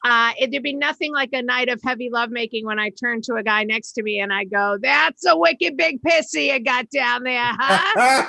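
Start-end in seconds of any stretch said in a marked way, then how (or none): 4.8-8.3 put-on Boston accent. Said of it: "the accent was affecting my sex life".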